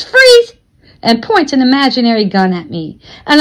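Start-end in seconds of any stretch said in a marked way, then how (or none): 0.0-0.5 sudden high, loud shout; screamed command. A narrator's reading. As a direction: startle them with a sharp burst. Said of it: "Mister Smitty screams".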